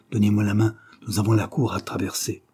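ordinary delivery, nothing marked

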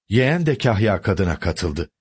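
plain reading voice

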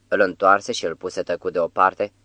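usual speaking voice

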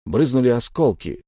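plain reading voice

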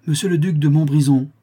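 loud, high, formal announcement; ringing ceremonial herald's call; servant's announcement. A narrator's reading. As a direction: announce the guest to the whole room. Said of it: "et on annonça".

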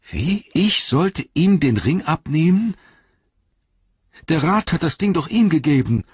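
aghast hobbit arguing with himself; Sam, shocked protest. A narrator's reading. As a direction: balk at the very idea.

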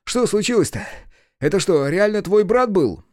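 high, loud, agitated partner voice; animated high-pitched buddy; winded sidekick. A narrator's reading. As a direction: question your friend after chasing him. Partner's figure voice after chase.

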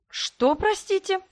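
lady faking surprise at the lord; feigned amazement. A narrator's reading. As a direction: play innocent shock. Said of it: "Я сделала вид, что изумлена".

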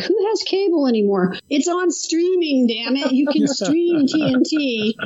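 put-on high grumpy old-timer rant; crazy old coot. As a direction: rant like a cranky old geezer. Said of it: "young whippersnapper".

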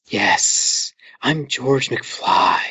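slow, breathy whispered George McFly; hoarse whisper, like a lost voice; weird whisper. Retold or imitated. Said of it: "this weird whisper".

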